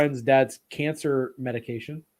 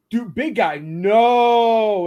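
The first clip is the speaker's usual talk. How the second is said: higher and louder, stretched no; alarmed warning; 7 semitones higher, 7 dB louder, much slower than usual. Retold or imitated. Festival friends stopping him eating brownies, loud high warning.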